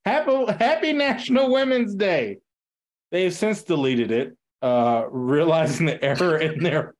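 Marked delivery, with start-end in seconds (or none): 0.1-2.4 higher, imitating a chirpy greeting; mock-cheery post. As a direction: read the post with fake celebration. Mock-quoting the brand's social post.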